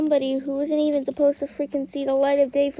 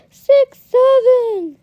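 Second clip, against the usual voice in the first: loud, drawn-out catchphrase call; mock meme shout; 10 semitones higher, 9 dB louder, much slower than usual. Shown as a demonstration. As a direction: belt it out like the meme, stretched. Mimics the meme's shouted catchphrase.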